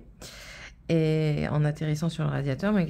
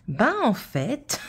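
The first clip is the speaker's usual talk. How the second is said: slow, stretched and higher ben en fait, like starting a tale; drawn-out storyteller opening; 6 semitones higher, 3 dB louder, much slower than usual. Retold or imitated. Mother imitating daughter starting her tale, slow and drawn out. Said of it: "elle raconte ça comme une histoire".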